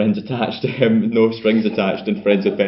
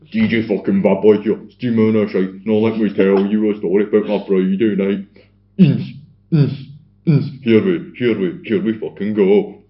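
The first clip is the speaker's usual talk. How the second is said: louder hammed-up club DJ; blaring DJ hype voice; 7 dB louder than usual. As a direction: bellow it like a schemy speaker announcer. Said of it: "it's just blaring".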